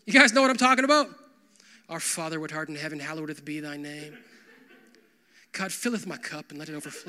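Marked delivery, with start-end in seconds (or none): 1.9-7.1 soft, hurried, stiff churchy recitation; mock King James prayer. Pastor mocking a false formal prayer to God, softer. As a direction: parody a pompous olde-English prayer. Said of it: "caricature of ourselves to God".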